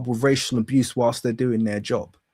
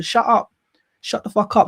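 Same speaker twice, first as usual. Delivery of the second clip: angry retort; 8 semitones higher than usual. Real, not acted. Angry retort to mocked view.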